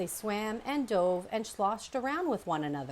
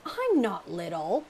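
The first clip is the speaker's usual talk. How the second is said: muttered snap; 5 dB louder than usual. A narrator's reading. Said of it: "snapped Thorn under his breath".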